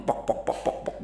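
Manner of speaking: whispered pok clucks; hens grumbling. Pretend. Hens grumble and go back to sleep, whispered clucks. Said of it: "kembali bergumam".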